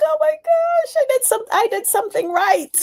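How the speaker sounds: high-pitched gleeful squeal, retold; elated disbelief. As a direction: squeal it like a thrilled parent, very high. Retold delight at daughter's thanks.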